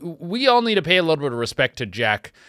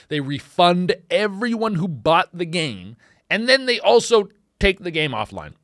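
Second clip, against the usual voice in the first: usual speaking voice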